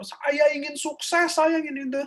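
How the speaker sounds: very high, drawn-out demo yell; demonstrating a slow high-pitched affirmation shout. Shown as a demonstration. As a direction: force the wish out in a high, stretched cry. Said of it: "Karena kita kan harus keluarkan".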